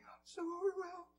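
a hint of a shaky passenger; trembling lady. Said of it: "ladies trembling".

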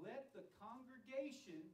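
slow, deliberate stress on "congregation"; emphasis on CONGREGATION. Shown as a demonstration. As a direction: draw out "congregation" with clear emphasis. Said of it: "I'm intentionally emphasizing that word".

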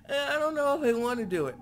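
high, mocking whiny voice. Player mocking the reluctant character, high whiny voice. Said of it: "she's like".

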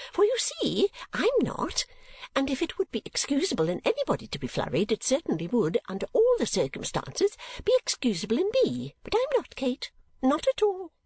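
high, flustered mother voice; Mrs. Nickleby. Acted. Mother insisting she is not flurried, high-pitched.

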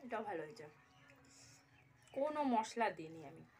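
eating with hands while speaking; talking while chewing. Real, not acted. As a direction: mouth partly full of food. Seen 0: Bite taken at -3.0; lips closing between words suggest chewing.

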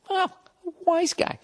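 mock Three Stooges voice; Stooges wise guy. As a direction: squeak it like a slapstick comic. Imitating a Stooges voice.

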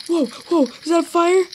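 high loud whoa; alarmed surprise. Real, not acted. Gamer spotting a fire, alarmed high and loud. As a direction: jump at a sudden fire.

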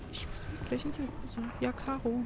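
quiet and tense, hiding; hushed nervous muttering; scared hush. Real, not acted. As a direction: keep the fear down to a murmur.

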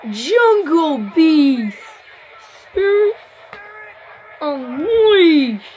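slow, drawn-out loud call; hero shout. Pretend. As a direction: belt the morph call. Morphing call in hero game.

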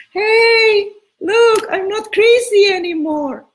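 mock frantic plea. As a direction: play the desperate ex, overdone and whiny. Mocking a desperate ex's plea.

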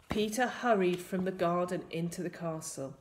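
ordinary delivery

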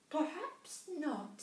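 high, slow squeaky mouse; a tiny mouse gasping in wonder; little mouse voice. A narrator's reading.

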